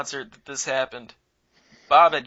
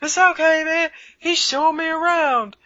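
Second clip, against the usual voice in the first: put-on much higher, goofy voice of a carefree drunk; drunk friend; 14 semitones higher, 4 dB louder than usual. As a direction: play the happy drunk, pitched way up. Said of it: "He goes".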